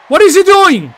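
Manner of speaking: stunned outcry; baffled shout. Real, not acted. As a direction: demand it at the ring in shock. Baffled by the intruder.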